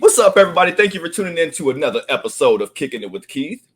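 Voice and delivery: high, loud greeting easing down; show intro. Acted. Host welcomes listeners to the episode, energetic show intro. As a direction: burst in brightly to welcome the audience.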